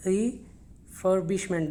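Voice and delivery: slow refurbishment, pause after the first syllable; syllable-by-syllable pronunciation. Shown as a demonstration. Teacher sounding out a word for learners, in parts. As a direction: split off the RE in refurbishment, then finish.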